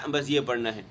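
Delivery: normal talking voice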